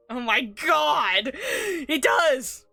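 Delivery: loud, scandalised shout; real shock, high and loud; shocked outburst. Real, not acted. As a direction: cry out in gleeful disbelief. Shocked reaction to friar's dirty line.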